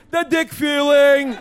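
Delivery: slow, stretched catchphrase for everyone to join; drawn-out call to the crowd. Acted. Joey leads the audience in his catchphrase, slow and drawn out. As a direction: drag out The dick feeling so the audience chants along. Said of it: "Come on, say it with me".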